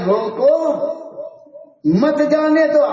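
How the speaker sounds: rising "روکو", break, high "مت جانے دو"; pause after روکو. Acted. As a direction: insist on "روکو" alone, then "مت جانے دو". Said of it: "بولے یہ تو لکھا ہے".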